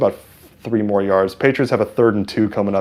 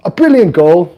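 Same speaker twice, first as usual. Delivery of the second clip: put-on high, louder English-accented match commentary; English soccer announcer; 10 semitones higher, 5 dB louder than usual. Streamer imitates English announcers calling a goal, English accent. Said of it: "one of those English announcers".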